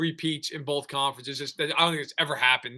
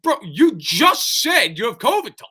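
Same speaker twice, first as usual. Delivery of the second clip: slow, loud, higher disbelieving bro; incredulous retort; 7 semitones higher, 6 dB louder than usual. Retold or imitated. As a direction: spell it out in mock disbelief. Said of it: "It's like".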